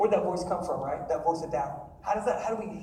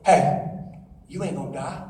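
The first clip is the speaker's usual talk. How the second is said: low-pitched coaxing serpent; deep tempter's voice; tempting serpent voice; 7 semitones lower, 4 dB louder than usual. Acted. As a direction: drop low, with a punched opening call. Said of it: "Here comes that voice".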